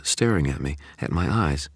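usual speaking voice

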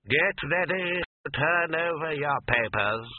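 slow, commanding invigilator; exam proctor. A narrator's reading. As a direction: announce to the whole hall. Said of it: "said the invigilator".

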